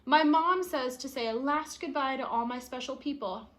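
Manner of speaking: plain reading voice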